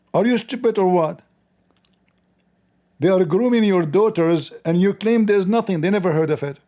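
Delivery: loud, high, furious yelling; angry rant. Real, not acted. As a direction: shout it down in outrage. Ranting at the news anchor.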